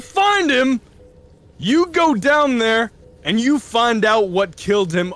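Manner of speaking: commanding shout. Acted. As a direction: order them down like a gang boss. NPC boss giving orders.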